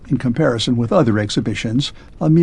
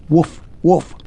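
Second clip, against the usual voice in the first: barking; 3 semitones higher than usual. A narrator's reading. Man turning into a dog barks.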